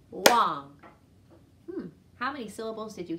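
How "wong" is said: loud single-beat "Wong"; clapped syllable. Shown as a demonstration. Teacher clapping a staff name with the class. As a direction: punch "Wong" as one clap. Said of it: "Ready? Go".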